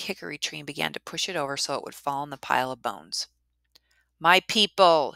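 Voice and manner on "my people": loud calling shout. Acted. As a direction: call out to wake the dead. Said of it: "he shouted".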